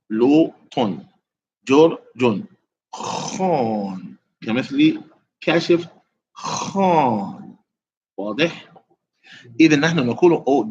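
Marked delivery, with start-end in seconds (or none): 2.9-4.2 stretched out. Shown as a demonstration. 6.3-7.6 stretched out. Shown as a demonstration.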